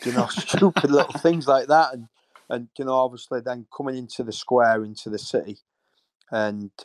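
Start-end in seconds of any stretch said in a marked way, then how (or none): 0.1-1.9 laughing while talking. Real, not acted. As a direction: chuckle through the words. Laughing at a funny memory.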